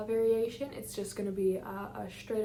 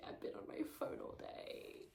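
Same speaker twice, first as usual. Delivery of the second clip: tired whisper; 11 dB quieter than usual. Real, not acted. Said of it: "I'm really tired right now".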